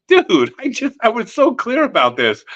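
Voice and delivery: high, whiny disbelief, lightly voiced; exasperated principal. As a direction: play the principal who feels betrayed. Quoting the annoyed principal.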